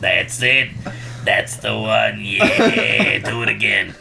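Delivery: low, sleazy boss voice; seedy New York manager. Retold or imitated. Sleazy manager coaching his girls, low and leering. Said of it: "seedy manager".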